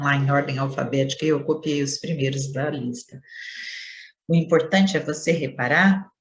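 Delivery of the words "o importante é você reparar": ordinary delivery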